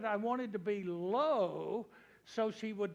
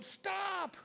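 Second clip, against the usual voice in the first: retold call; 10 semitones higher than usual. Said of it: "running down the driveway going".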